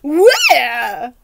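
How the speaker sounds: put-on loud alarmed old knight; loud where. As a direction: snap round and call it. Knight searching.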